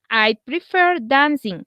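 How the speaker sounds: slow, careful model; slow model sentence. Shown as a demonstration. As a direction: say it slowly for the class. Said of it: "teacher models example slowly".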